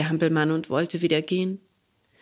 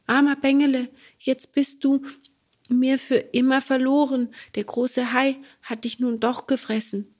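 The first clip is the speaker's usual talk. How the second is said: growing dismay; 7 semitones higher than usual. Acted. Bengele realizes the goat was the fairy, dismayed. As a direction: let the realization break through.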